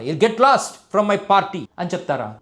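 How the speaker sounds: ordinary delivery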